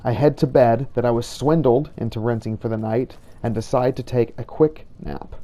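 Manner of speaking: ordinary talk, nothing marked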